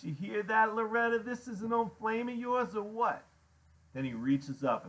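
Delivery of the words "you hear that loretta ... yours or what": higher, louder jeering question; mocking biker. A narrator's reading. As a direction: jeer at the visitors for her benefit. Iggy laughing, mocks to Loretta.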